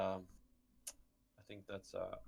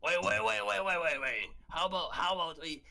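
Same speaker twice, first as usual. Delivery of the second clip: high, loud rapid-repeat interruption; urgent objection; 8 semitones higher, 4 dB louder than usual. Acted. Stopping companions from hiding the egg, urgent. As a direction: cut in urgently to halt everything.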